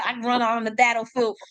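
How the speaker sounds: ordinary talk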